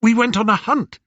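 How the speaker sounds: high, animated storytelling voice; Odysseus reminiscing. Acted. Odysseus retelling the boar hunt to his old nurse, animated. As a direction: relive the tale aloud.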